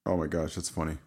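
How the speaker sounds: ordinary talk, nothing marked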